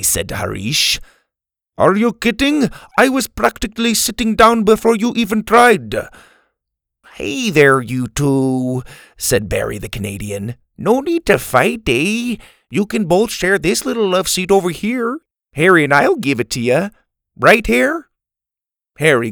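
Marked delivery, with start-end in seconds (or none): 1.8-5.9 high, loud indignant squabble; indignant Indian accent. A narrator's reading. Harish argues over seat. 7.1-18.0 high, loud cheery Canadian; friendly peacemaker, eh; Canadian accent. A narrator's reading. Barry the Canadian mediates.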